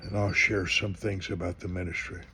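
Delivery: ordinary delivery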